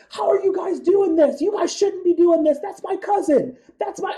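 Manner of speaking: high, loud, indignant imitation of the cousin; mimicking her cousin's high-pitched protest to the police; upset cousin. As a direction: squeal it out like an outraged all-American boy. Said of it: "getting very upset off to the side".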